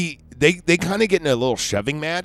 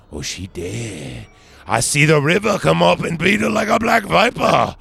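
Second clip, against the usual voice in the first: Riverman; 4 semitones higher, 3 dB louder than usual. Retold or imitated. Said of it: "he comes down and he goes like".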